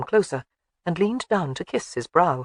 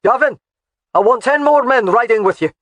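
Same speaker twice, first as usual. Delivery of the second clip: louder, higher commanding shout; shouted order; 6 semitones higher, 9 dB louder than usual. A narrator's reading. Husband shouts an order to his soldier, shouting. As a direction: bark it across the yard. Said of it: "he shouted".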